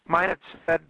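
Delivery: ordinary talk, nothing marked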